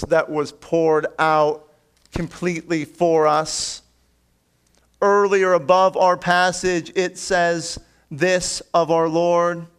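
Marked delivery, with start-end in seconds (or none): none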